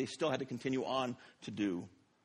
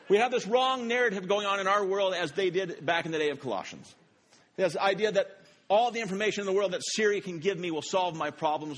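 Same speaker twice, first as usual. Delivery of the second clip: raised, ringing sermon voice; preaching; 4 semitones higher, 4 dB louder than usual. Acted. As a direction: preach it to the room. Preaching to congregation.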